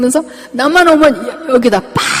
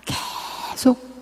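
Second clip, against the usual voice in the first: exhausted drawn out; 11 dB quieter, much slower than usual. Retold or imitated. Tired patient voicing exhaustion.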